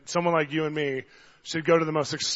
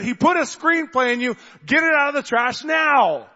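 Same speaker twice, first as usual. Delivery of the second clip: high, loud, slow insistence; intense urging; 9 semitones higher, 5 dB louder than usual. Retold or imitated. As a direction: make her hear it across the room. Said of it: "I had to say it intensely 'cause it's across the place".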